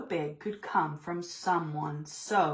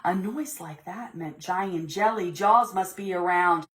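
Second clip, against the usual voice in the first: ordinary delivery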